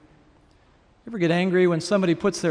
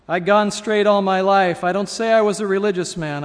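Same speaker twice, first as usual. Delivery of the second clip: louder, higher, self-righteous protest; indignant self-justification; 4 semitones higher, 7 dB louder than usual. A narrator's reading. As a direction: protest your good record loudly, as story dialogue. Self-righteous ghost protests his record.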